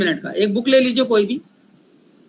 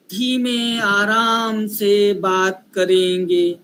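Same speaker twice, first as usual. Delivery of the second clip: slow demo; 5 semitones lower, much slower than usual. Shown as a demonstration. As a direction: drag the words out calmly to show slow speakers. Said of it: "कई लोग बहुत slow बोलेंगे".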